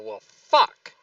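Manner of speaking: loud cursing outburst in character; character's frustrated yell. Acted. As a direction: yell it out, punching the curse.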